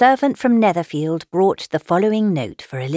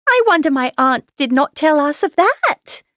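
put-on high, louder girlish protest; Lydia crying out; 9 semitones higher, 5 dB louder than usual. A narrator's reading. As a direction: exclaim it in an excited young voice. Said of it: "cried Lydia".

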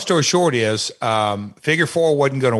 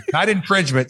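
laughing; 5 semitones higher than usual. Real, not acted.

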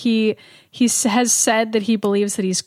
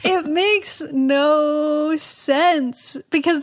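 very slow, drawn-out higher words; exasperated disbelief; 5 semitones higher, much slower than usual. Real, not acted. Host baffled that people still pre-order, exasperated disbelief. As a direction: stretch each word in amused bafflement.

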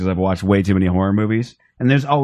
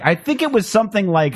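much higher, musing imitation; mock Teddy; 10 semitones higher than usual. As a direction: pretend to dredge up a fact. Imitating Teddy recalling trivia.

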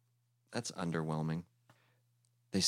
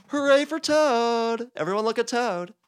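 shouted cheer; 9 dB louder than usual. Acted. Toad bowing on stage, shouting his own praise. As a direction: crow it to the whole theater. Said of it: "And he shouted".

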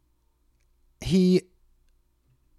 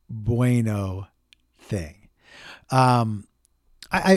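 ordinary delivery

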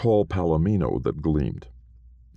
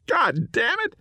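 offended little ghost. A narrator's reading. Small man stumbling.

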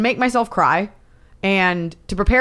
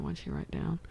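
whisper; 17 dB quieter than usual. Retold or imitated. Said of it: "I'm like".

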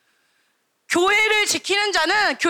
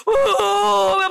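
mock wail, long and drawn out; a preacher acting out a boy's loud lament; imitated wailing; 4 semitones higher, 4 dB louder, much slower than usual. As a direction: wail like a child weeping in prayer. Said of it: "통곡하며 울기 시작하는 거야".

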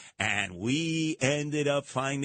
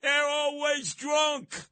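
high, slow, shouted punchline; emphatic jab; 10 semitones higher, much slower than usual. Real, not acted. Host mocking the Kennedy women, slow high shout. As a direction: drag it out and shout it.

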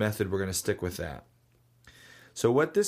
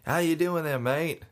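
lightly imitated Australian bloke saying hello; mild Australian accent, cheery; 7 semitones higher than usual. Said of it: "had a really thick accent".

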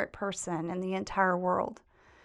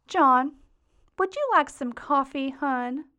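higher-pitched, sweet little Southern lilt; sweet Southern granny; 5 semitones higher, 4 dB louder, slower than usual. Retold or imitated. Granny offering her grandson-in-law coffee, in a sweet Southern voice. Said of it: "in her sweet little Southern voice".

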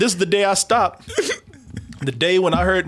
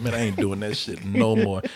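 quiet regret; 11 semitones lower, 6 dB quieter than usual. Real, not acted. Regretful vow.

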